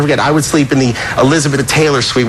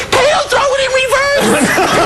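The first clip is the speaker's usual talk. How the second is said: squealed falsetto order, stretched out; slower than usual. Retold or imitated. Prankster ordering his driver to back up, falsetto shout. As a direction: cry it out in a very high impersonation.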